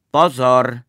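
very loud shouted warning; called-out warning. A narrator's reading. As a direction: shout it out to everyone watching. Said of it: "zvolal podruhé".

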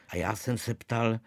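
usual speaking voice

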